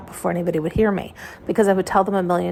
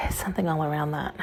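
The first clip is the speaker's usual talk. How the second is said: whisper; 4 semitones lower, 7 dB quieter than usual. Real, not acted. Whisper heard.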